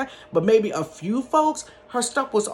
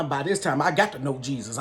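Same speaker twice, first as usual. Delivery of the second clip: ordinary delivery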